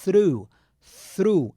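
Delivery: "through" with the tongue between the teeth, then an R; "Through": tongue out for th, then roll into r; th sound. Shown as a demonstration. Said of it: "a língua entre os dentes e soltar um R". ("through" com a língua entre os dentes e um R depois)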